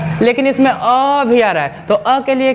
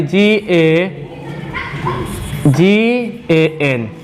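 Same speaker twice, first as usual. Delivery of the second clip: slow spelling; 3 semitones lower, much slower than usual. Shown as a demonstration. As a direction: spell out ए and एन very slowly, letter by letter. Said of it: "ग में क्या हो जाएगा जी".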